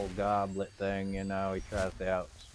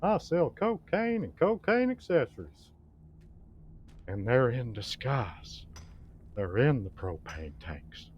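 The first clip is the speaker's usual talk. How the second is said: Hank Hill; 2 semitones higher, 5 dB louder than usual. Retold or imitated. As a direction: pitch it like a proud propane man. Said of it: "I can do Hank Rutherford Hill".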